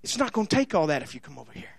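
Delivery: low, fast, hushed tempter; luring voice. Acted. Voice of sin luring the listener in a low fast hush. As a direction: whisper it like a slick seducer. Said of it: "there'll always be voices that say".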